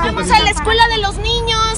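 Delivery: high, lifted rallying cry; rallying call. Real, not acted. Rallying neighbours to help the victims, high and lifted. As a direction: fire up the crowd to help.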